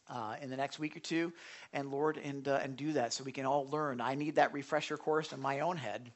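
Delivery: earnest spoken prayer, opening loud then settling; heartfelt closing prayer. Real, not acted. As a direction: pray to the Lord with the group.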